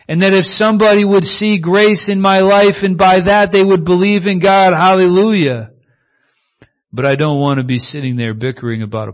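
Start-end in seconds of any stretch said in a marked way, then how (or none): 0.1-5.7 higher, louder rising preach to hallelujah; preaching at full force. Real, not acted. Preacher to congregation, building to loud hallelujah. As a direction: lift it up to a shout of praise.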